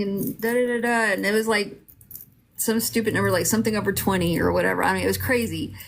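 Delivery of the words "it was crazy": normal talking voice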